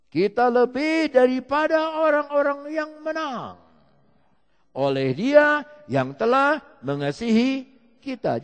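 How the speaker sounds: loud, slow, high proclamation of the verse; forceful scripture reading. Real, not acted. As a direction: proclaim every word to the crowd. (membaca ayat dengan lantang dan lambat)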